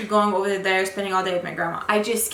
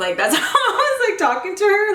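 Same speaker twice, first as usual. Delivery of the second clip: laughing; 11 semitones higher, 4 dB louder than usual. Real, not acted. Laughs at her own tone.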